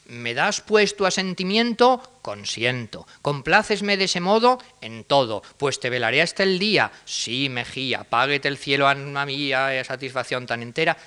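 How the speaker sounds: stagy Don Juan dialogue; Tenorio recital. Shown as a demonstration. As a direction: declaim it like classic stage drama. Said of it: "¿Cuál es el modelo de esto?".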